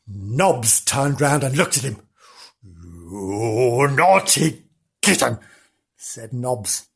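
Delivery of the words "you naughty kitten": low, slow, drawn-out telling-off; slow, mock-stern reproach; scolding dog. A narrator's reading. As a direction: stretch out the scolding. Dog scolds kitten in story.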